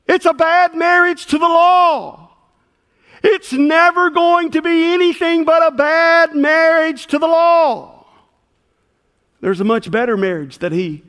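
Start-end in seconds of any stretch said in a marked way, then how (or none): 0.1-7.9 loud, raised preaching climax; preaching at full force. Real, not acted. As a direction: drive it home to the congregation.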